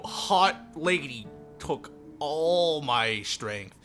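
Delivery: ordinary talk, nothing marked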